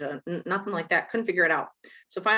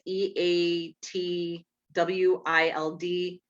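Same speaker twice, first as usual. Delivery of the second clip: spelled out slowly; much slower than usual. Shown as a demonstration. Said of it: "if you guys have a pen and paper".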